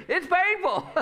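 high, loud sung-out call-back for the congregation to echo. Shown as a demonstration. Pastor leading the congregation in a repeat-after-me, high and loud. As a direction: lead the room, pitch way up. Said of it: "Say that with me".

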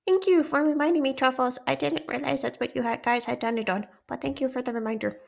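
high-pitched put-on girlish voice; high, sweet character voice; grateful little character. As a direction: pipe thanks in a high voice. Voices C K thanking Truffles.

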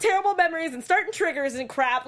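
a hammed-up no, no at the TV; mock outburst. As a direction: scold the character, worked up. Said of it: "And I was like".

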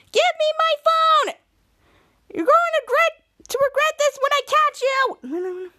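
high, drawn-out shrieked threat; acted furious yell. Girl chasing boy for her phone, mock furious shriek. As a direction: yell slowly at a very high pitch.

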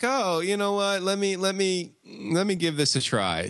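high, eager uncle, lightly voiced; a hint of a keen uncle trying it; uncle's voice.

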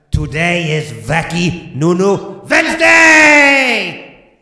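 loud, slow drawn-out proclamation; hammed-up shouted sign-off; loud announcement. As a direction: belt the show name.

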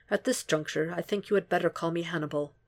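quiet, barely holding the voice steady; unsteady voice. A narrator's reading. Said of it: "lost the trick of keeping his voice even".

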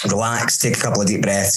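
ordinary talk

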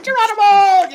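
high, loud playful yell of Geronimo; mock Geronimo yell. Recalling childhood play, a high laughing Geronimo yell. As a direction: shout it like a kid jumping off something. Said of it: "you scream Geronimo".

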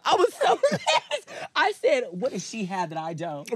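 high, laughing, slowed down; laughing while telling. Real, not acted. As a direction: laugh through the words.

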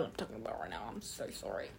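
soft unvoiced whisper; whispered aside. Real, not acted. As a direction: hush it like an apology. Whispered apology.

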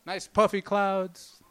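normal talking voice

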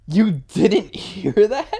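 high, loud, drawn-out question through laughter; retold laughing disbelief. Retelling his joke to a coworker in headphones, laughing disbelief. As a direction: act out the amazed question, laughing.

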